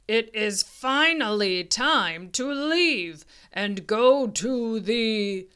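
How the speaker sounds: lightly voiced big booming dinosaur; booming papa dinosaur. A narrator's reading. Papa Dinosaur announcing they must leave, booming. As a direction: play a big dinosaur dad. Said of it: "in his loud, booming voice".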